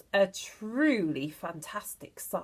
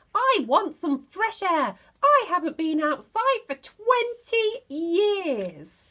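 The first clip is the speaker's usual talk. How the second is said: put-on screechy old woman; 9 semitones higher, 6 dB louder than usual. Grandma shouting down.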